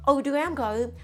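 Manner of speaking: usual speaking voice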